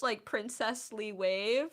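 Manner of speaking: normal talking voice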